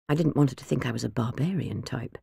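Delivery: ordinary delivery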